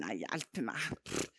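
a low dismissive mutter of someone else; dismissive scoffing. Retold or imitated. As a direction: brush it off as nothing, pff. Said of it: "Kanskje andre tenker".

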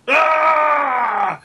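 high, loud barbarian battle yell; barbarian war cry. Acted. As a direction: let out a wild war cry.

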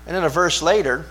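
ordinary talk

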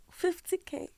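hushed, reluctant whisper of a figure. Real, not acted. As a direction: whisper it as if nobody should hear. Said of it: "I'm whispering the figure".

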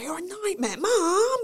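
put-on high, childish cheeky complaint; teasing kid. Kid teasing their mother.

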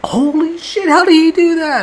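high, loud astonished voice; high awestruck disbelief; amazed. Retold or imitated. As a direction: gasp it out in wonder.

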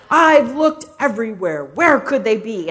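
very loud playful searching call; mock-baffled dad. Dad plopped on the bed atop his hidden children, loud mock bafflement. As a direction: boom it out in pretend bewilderment. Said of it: "deep, grumbly voice".

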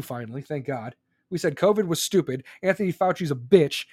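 ordinary talk, nothing marked

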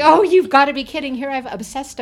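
loud, high, laughing disbelief; bakery owner hearing the bride never noticed the missing tier, loudly incredulous; disbelieving outburst. Retold or imitated. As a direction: blurt it out, stunned and amused. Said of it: "And I'm like".

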